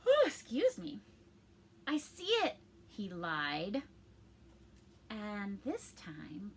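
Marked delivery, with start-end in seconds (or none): 0.1-1.0 startled. Real, not acted. 1.8-2.6 put-on bright boy voice. Pinocchio comforting his father.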